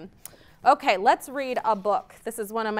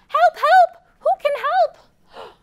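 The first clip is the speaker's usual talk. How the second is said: high, loud little duck crying help; duck calling for help; 14 semitones higher, 8 dB louder than usual. Acted. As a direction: squeak out a panicky call for help.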